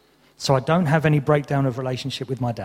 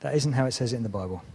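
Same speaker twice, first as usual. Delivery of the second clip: softer, faster restart of the line; quick quiet redo; 4 semitones lower, 7 dB quieter, faster than usual. Real, not acted. Preacher quietly and quickly repeating after pressing the wrong button. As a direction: toss it off again quickly and quietly. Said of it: "Oh, wrong button".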